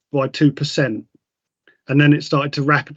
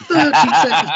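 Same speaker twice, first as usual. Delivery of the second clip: higher pitched laugh through the words; 11 semitones higher than usual. Real, not acted. Amused at the massage timing, laughing out the words. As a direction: giggle it out high.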